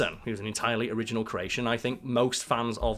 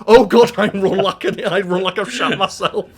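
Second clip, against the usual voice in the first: laughing dismay; 7 semitones higher, 11 dB louder than usual. Retold or imitated. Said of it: "he's like".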